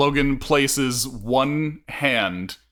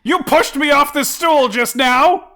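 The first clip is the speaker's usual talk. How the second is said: high, loud, slow indignant yell; a customer yelling accusations at the secretary; outraged accusation; 8 dB louder than usual. Acted. Customer accusing after fall.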